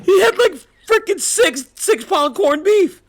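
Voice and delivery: high-pitched, talking through laughter, slowing at the end; laughing. Real, not acted. Telling the interviewer about the corned beef, laughing. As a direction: still giggling while getting the words out.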